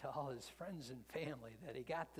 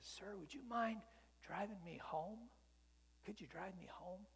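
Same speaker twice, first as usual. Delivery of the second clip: put-on shy little boy; 7 semitones higher than usual. Kid asks to be driven home.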